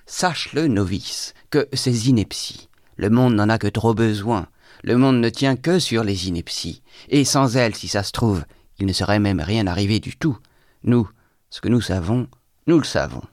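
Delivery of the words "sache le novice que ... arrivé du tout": plain reading voice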